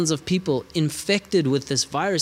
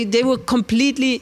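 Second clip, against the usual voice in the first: normal talking voice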